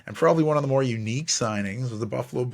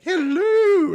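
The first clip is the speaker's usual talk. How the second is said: very high, louder sing-song hello; Miss Doubtfire voice; 7 dB louder than usual. Retold or imitated. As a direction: greet like a falsetto old nanny. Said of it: "like Miss Doubtfire".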